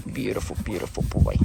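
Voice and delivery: soft whisper to the horse; hushed fond whisper; whispered praise. Real, not acted. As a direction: breathe the praise close to him. Murmuring to her horse.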